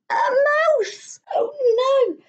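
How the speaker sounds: put-on high, panicked child cry; alarmed yell. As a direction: squeal it in fright. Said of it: "Neeraj yelled".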